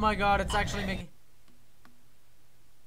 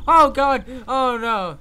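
higher, louder frightened exclaims; scared outbursts; panicked cries; 6 semitones higher, 11 dB louder, slower than usual. Real, not acted. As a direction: cry out in alarm. Panicking in horror game.

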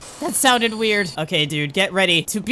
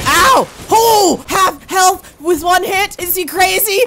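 loud, high and slow disbelief; shocked outcry; 8 semitones higher, 6 dB louder, slower than usual. Real, not acted. Gamer losing half his health at once, shocked shout. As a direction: shout it out, stunned by the hit.

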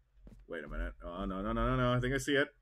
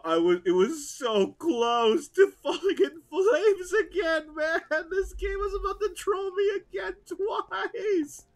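much higher, slow, half laughing half crying; 7 dB louder, slower than usual. Real, not acted. Gamer after nearly losing it all, laughing through tears. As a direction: relief spilling into tearful laughter. Relief after near loss.

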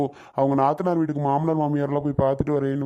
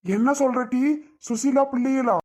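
high-pitched, incredulous questioning; surprised at unexpected news; astonished; 8 semitones higher than usual. Acted. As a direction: ask it in startled disbelief, voice jumping up. Hears the children have returned.

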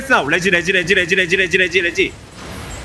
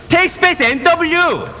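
urgent shout; 7 semitones higher, much slower than usual. Real, not acted. Urgent repositioning call.

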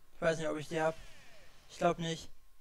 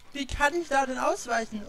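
panicking gamer; 8 semitones higher, 7 dB louder than usual. Real, not acted. Frantic under attack.

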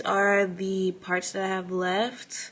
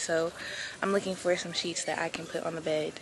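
ordinary talk, nothing marked

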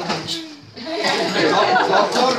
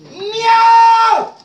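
loud meow call; 12 semitones higher, 9 dB louder than usual. Acted. Calling out cat sound to crowd.

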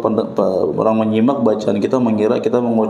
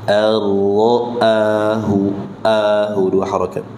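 Ar-ra'ahu stretched slowly, mad held two beats; slow drawn recitation; slower than usual. Shown as a demonstration. Teacher reciting slowly for students to follow. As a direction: draw out each syllable carefully.

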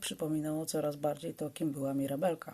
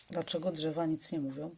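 ordinary delivery

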